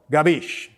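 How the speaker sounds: Capisci said as "gah-beesh", clipped Italian way; Italian pronunciation. Shown as a demonstration. Correcting people who mispronounce it, says Capisci as gabeesh. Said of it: "That's how we say it".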